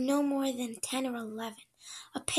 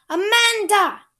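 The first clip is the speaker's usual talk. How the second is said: high, loud call, holding the name out long; loud summons; 9 semitones higher, 15 dB louder, slower than usual. Acted. Cook shouting for Amanda to come back to the kitchen, loud call. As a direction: bellow the name down the hall to fetch her. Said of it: "Cook's voice called her back".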